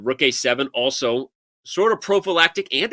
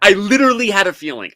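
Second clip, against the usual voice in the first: excited; 6 semitones higher, 6 dB louder than usual. Real, not acted. Excited gloating at Fabi.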